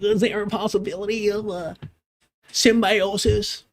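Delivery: mincing impression of a coy intellectual; mocking coy voice. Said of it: "he's being all coy. He's being all cute".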